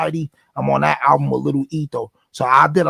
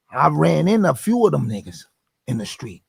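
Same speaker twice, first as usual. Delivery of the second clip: ordinary talk, nothing marked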